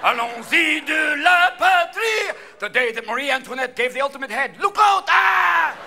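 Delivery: loud, high mock-French rallying cry; French accent shout. Frenchman celebrating Bastille Day, shouted French accent. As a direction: holler it in a broad French accent. Plays a rowdy French reveler.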